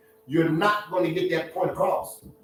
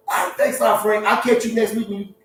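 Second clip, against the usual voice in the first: chatty lion; 8 semitones higher than usual. Pretend. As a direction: greet your prey like an old pal. Lion chatting to zebra Frank.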